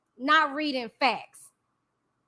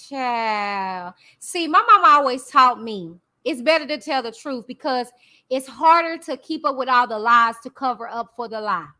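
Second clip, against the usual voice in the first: ordinary talk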